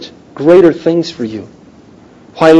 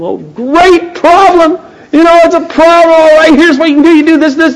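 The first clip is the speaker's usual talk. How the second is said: eager pastor; 12 semitones higher, 4 dB louder than usual. Retold or imitated. Pastor delighting in a caller's problem, eager. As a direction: relish the problem like a gift.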